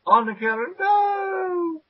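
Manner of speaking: high, hammed-up cry; mock terrified wail. Victim refusing the counter, high mock wail. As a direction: wail the last word very high, badly frightened in jest.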